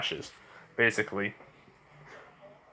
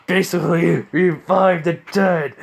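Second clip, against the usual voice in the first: usual speaking voice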